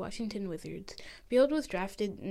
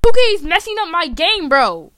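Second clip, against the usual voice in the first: much louder, higher, fed-up shout; angry yelling; 8 semitones higher, 17 dB louder than usual. Acted. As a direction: blame the game, yelling. Yelling back about the game.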